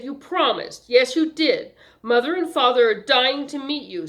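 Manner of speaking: affected, pushy sweetheart; insistent socialite. A narrator's reading. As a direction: press the point, allowing no refusal. Young woman insisting.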